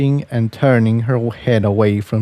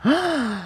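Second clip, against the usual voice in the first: sharp high gasp; little gasp; 5 dB quieter than usual. Acted. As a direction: gasp in shock. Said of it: "let out a little gasp".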